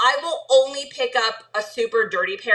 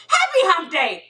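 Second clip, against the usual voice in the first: ordinary talk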